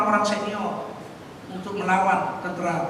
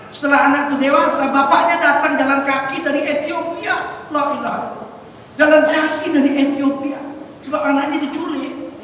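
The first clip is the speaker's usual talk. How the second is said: preaching fervor; 5 semitones higher, 4 dB louder than usual. Real, not acted. Preacher raising voice in story.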